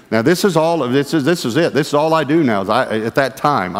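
ordinary delivery

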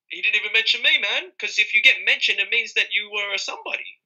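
mocking jealous rappers. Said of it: "They're like".

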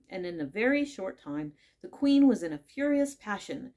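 ordinary delivery

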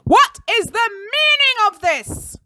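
high, loud, slow outraged demand; furious bellow. A narrator's reading. Enraged teacher demands an explanation, bellowing. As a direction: bellow it at the children. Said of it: "before he managed to bellow".

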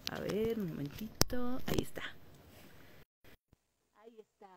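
whispered aside; whispering. Real, not acted. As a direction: whisper it while fiddling with gear. Fixing the audio mid-stream.

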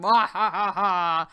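slow evil cackle; a gleeful villain's mwahaha; mad scientist. Pretend. Evil laugh after releasing moths.

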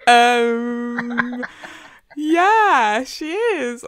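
high, loud drawn-out 'um, yeah'; retold knowing response, loud and high; pointed retort. Acts own reply to the message.

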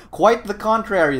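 ordinary talk

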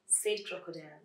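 usual speaking voice

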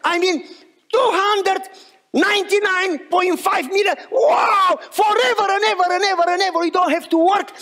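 wife shouting the news to everyone, over the moon; screaming with joy. Retold or imitated. Wife telling everyone about the millions, shouting with joy. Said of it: "She starts screaming and jumping".

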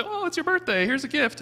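high, sugary offering voice; sweet offer. Pretend. Offering a birthday gift to someone who dislikes him, bright high voice. Pretend gift giving to enemy.

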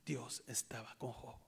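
slow hushed whisper; slow whispered assurance. Real, not acted. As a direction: let it sink in, whispered. Whispered emphasis in sermon.

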